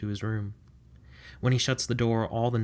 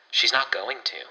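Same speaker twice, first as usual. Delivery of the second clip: disdainful; 6 dB louder than usual. Acted. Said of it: "Warren says disdainfully".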